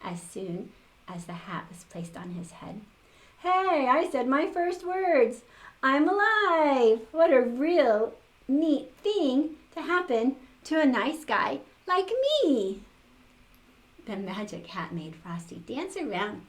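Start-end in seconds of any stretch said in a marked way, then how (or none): 3.4-12.8 high, loud, slow snowman voice; loud slow jolly snowman. A narrator's reading. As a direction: delight at being alive. Frosty comes alive.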